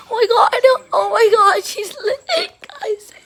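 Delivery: squealing, high laugh-cry. Real, not acted. Swarmed by puppies, she laugh-cries to her viewers.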